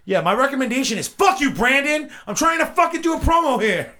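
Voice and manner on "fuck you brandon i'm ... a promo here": high, loud outburst at a co-host; mock-angry yell. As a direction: blow up at your co-host mid-promo. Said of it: "I'm sorry I yelled at you".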